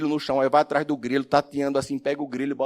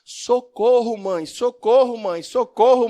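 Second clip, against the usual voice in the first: much higher, louder mock cry of a frightened child; child crying for help; 9 semitones higher, 5 dB louder, slower than usual. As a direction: imitate the blind boy calling his mother in panic. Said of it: "E ele começa a gritar". (imitando o grito desesperado de uma criança)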